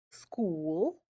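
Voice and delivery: "school" slowly with a long stretched oo; drawn-out oo. Shown as a demonstration. Teacher showing the long oo to students. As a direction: draw out the oo in "school". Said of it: "You can hear the oo in these words".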